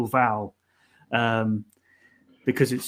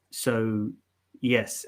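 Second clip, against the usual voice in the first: normal talking voice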